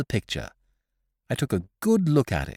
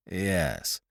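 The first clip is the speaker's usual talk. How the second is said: a low, hesitant "Yes" said much softer; unsure, holding back; doubtful; 6 semitones lower, 6 dB quieter than usual. A narrator's reading. Corky on the phone, doubtful about his portrait. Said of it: "His voice sounded rather doubtful".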